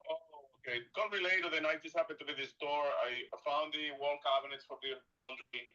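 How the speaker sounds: normal talking voice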